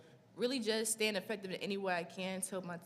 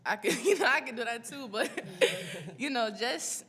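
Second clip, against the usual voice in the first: laughing, higher and louder; 4 semitones higher, 9 dB louder than usual. Real, not acted. Player joking to reporters about toughness, laughing. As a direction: break into a laugh mid-sentence.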